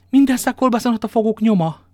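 high, loud wail of complaint; outraged cry. Acted. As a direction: cry it out loudly, high-pitched. Innkeeper rails about his ruined cellar. (felháborodott, hangos jajveszékelés)

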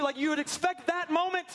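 high, excited preaching voice; preaching at full force. Acted.

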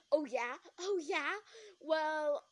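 loud, rising retort; challenging comeback. Acted. Jeremy answering back in a quarrel, challenging comeback.

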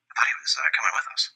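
understated in-character confusion; mildly confused. Acted. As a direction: say it quietly, a touch bewildered. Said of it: "Captain just looks a little confused".

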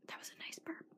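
normal talking voice

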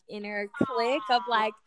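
ordinary delivery

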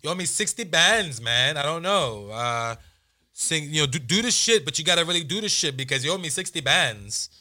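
sung-out [name] accent impression; [name]'s weird accent. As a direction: ham up [name]'s odd Toronto lilt. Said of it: "[name] got a weird accent".

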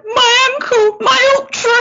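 high, wailing sob over the race. Retold or imitated. Runner sobbing to the doctor about missing her ultra, high wail. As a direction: cry it out, high and pleading.

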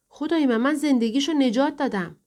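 affronted exclamation; indignant protest. A narrator's reading. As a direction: protest as if wrongly accused. Accused doctor protesting his innocence.

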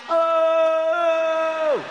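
high yelled Oh; friends yelling from behind. Retold or imitated. Friends behind on the trail yelling, a high loud shout. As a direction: shout it like friends calling out far back. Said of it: "they're like".

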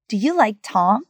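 "Tom" rising at the end; rising question intonation. Shown as a demonstration. Teacher shows how do questions sound, rising intonation on "Tom". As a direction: lift the pitch on "Tom" as a yes-or-no question. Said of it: "the intonation rises at the end".

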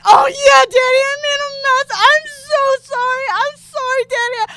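high, loud, whimpering wail; overwrought, high-pitched pleading; hammed-up wailing apology. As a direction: wail an apology in a mock-crying squeal. Acted overwhelmed apology in bed.

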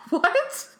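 high, breathless laughing disbelief. Real, not acted. Sudden realization, bursts out laughing.

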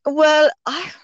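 usual speaking voice

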